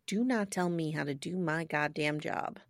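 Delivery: low, quiet and firm; low stern. Retold or imitated. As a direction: say it low and cold. Stern quoted rebuke.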